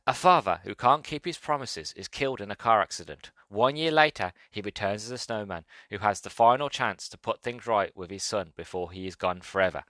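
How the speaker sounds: ordinary delivery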